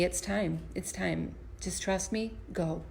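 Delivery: soft, quiet words felt from God; hushed and sure, like a calm nudge; gentle inner voice. Retold or imitated. Wife recalling God's urging in the garden, softly spoken. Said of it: "And I felt him say to me".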